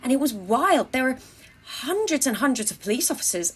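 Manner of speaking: usual speaking voice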